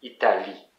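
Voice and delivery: French "Italie", final "lie" stressed; French pronunciation. Shown as a demonstration. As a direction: demonstrate "Italie" with weight at the end. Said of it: "in French, you say Italie".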